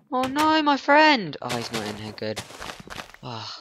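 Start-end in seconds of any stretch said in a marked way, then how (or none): 0.1-1.4 high, worried yelp; alarmed cry. Real, not acted. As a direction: cry out in sudden worry. Fears villager was killed.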